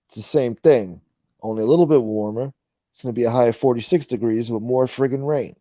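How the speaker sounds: loud newscaster weather read; weather report. Acted. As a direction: announce it like a TV weatherman.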